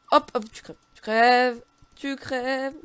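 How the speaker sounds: loud, high, stretched-out taunt; excited gamer shouting at an enemy; gleeful attack cry. Real, not acted. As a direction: draw out the kill cry. Urging a game enemy to die.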